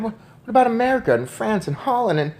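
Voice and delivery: put-on a boy who can't hold back his questions; excited pleading; eager boy. A narrator's reading. Boy pressing grandmother, rising.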